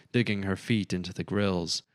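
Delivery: ordinary delivery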